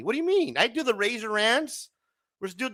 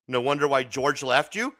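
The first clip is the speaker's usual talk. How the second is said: ordinary talk, nothing marked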